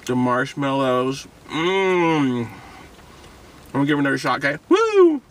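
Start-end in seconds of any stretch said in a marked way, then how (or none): 4.7-5.2 high, loud woo; excited whoop. Real, not acted. Vlogger whooping before the first bite. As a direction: cheer it out.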